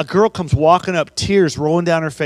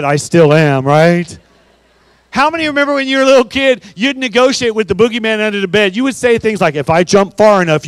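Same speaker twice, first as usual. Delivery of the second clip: excited crowd call-out; 3 semitones higher, 9 dB louder than usual. Real, not acted. Speaker to school assembly, loud and excited. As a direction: pump up the gym with big energy.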